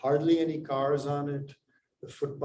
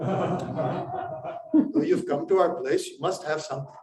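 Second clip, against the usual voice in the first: higher, laughing mock host who won't take no; insistent host; 4 semitones higher, 3 dB louder, slower than usual. Indian host pressing a reluctant guest, laughing insistence.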